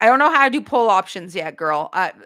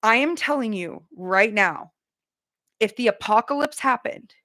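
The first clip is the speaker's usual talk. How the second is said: usual speaking voice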